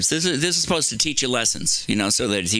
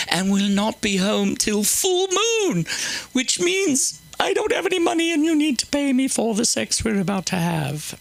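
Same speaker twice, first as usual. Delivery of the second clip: high, hammed-up seductress; seductive woman; 10 semitones higher than usual. Comic voicing the seductive woman from Proverbs. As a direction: coo it like a temptress.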